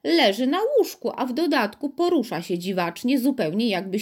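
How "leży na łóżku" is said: plain reading voice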